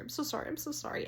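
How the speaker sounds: high little mock-mournful voice; retold sorrowful apology. Woman apologising to dead-seeming dog, high mock sorrow. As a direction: apologise high-pitched, as if grieving.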